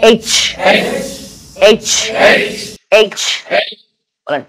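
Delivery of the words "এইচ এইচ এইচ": এইচ with a hissing breath like onion in hot oil; sizzling h. Shown as a demonstration. Teacher tells students to say এইচ like a sizzle in oil. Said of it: "এইভাবে বলেন".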